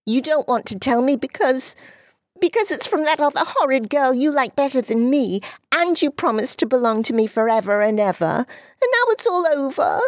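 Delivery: high, hurried, voice breaking into a cry; a jealous child welling up; high fast tearful girl's voice; jealous little girl near tears. Acted.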